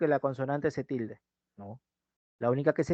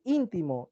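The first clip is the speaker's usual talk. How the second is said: "íntimo" slowly, as ÍN-ti-mo; stress on first syllable; 4 dB quieter, slower than usual. Shown as a demonstration. Teacher giving students another triple, "íntimo" stressed on ÍN. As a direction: hit the opening ÍN of "íntimo". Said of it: "Cambia, igual".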